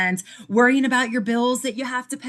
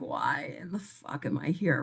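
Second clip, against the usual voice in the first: confused mutter; 5 semitones lower, 11 dB quieter than usual. Retold or imitated. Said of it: "you're like".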